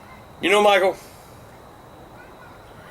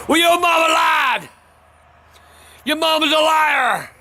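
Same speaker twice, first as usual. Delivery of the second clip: high, shouted accusation; mock outraged shout; 8 semitones higher than usual. Real, not acted. Shouting at kids about Santa.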